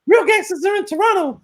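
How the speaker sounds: squeaky South Park Canadian send-up. Retold or imitated. Streamer mocks a Toronto chatter in cartoon Canadian voice. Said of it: "how they do the Canadians and South Park".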